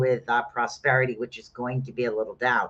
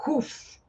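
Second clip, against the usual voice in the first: whoosh sound; 13 semitones higher, 6 dB quieter than usual. Shown as a demonstration. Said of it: "start to spark and literally".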